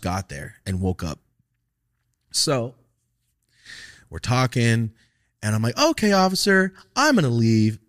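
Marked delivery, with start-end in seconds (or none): 5.8-7.8 high, loud, over-obliging reply; mock compliance. Student ends the talk with officer, mock compliance. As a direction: announce the exit with exaggerated politeness. Feigned compliance when leaving.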